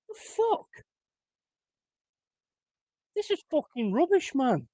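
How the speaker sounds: high, stunned question; can't believe it; incredulous; disbelieving. Real, not acted. Fan reacting to bad pass.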